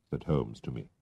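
ordinary delivery, nothing marked

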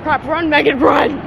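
"run, Megan, run" called out loud to herself; urging herself. Real, not acted. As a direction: shout at yourself to hurry up.

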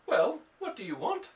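high, sharp questioning cat voice; sharp questioning cry. Acted. Mother voicing the cat's quick reply, high and sharp. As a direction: fire it back quick as a bouncing ball. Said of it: "a little sharp questioning cry".

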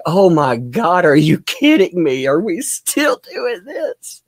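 lightly voiced weary disbelief, dragging at the end; a hint of an eye-rolling lawyer; exasperated lawyer. Streamer voicing the opposing lawyer, mildly exasperated. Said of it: "Camille is staring at the ceiling like".

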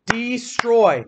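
destroy drawn out slowly, each part clear; stretched word. Shown as a demonstration. Teacher drawing out destroy slowly for the class. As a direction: say destroy slowly so kids hear the oi. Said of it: "Is that getting circle or square?".

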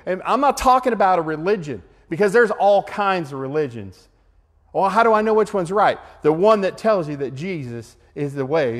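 rally the room to reach out; fervent preaching. Acted. Preaching at full force to group.

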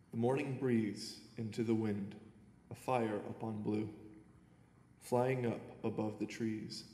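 earnest poem reading with pauses between lines; poem recital. Acted. Young poet reads his prize poem to the audience, earnest recital. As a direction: read each line as a verse, letting it land.

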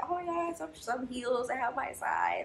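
high, hushed excited squeal, fading to a whisper; retold excitement. Shopper finding heels in her size, hushed high excitement. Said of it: "because I was so excited".

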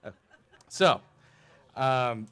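ordinary talk, nothing marked

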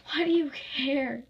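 acted tearful outburst; sobbing protest. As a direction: throw it out as a hurt protest. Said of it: "she sobbed".

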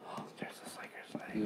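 soft admiring whisper. Real, not acted. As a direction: breathe it out in awe. Hushed, admiring the sprue.

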